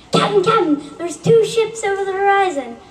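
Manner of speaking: urgent call. Acted.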